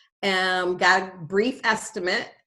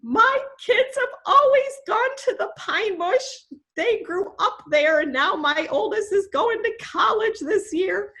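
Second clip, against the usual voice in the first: wife's words; 11 semitones higher than usual. Retold or imitated. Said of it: "then she says".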